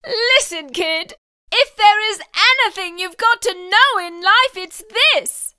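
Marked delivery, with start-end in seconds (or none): none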